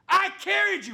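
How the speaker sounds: very high, loud imitated scream; screaming like coach. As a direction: yell it like a football coach. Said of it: "she started screaming like a football coach".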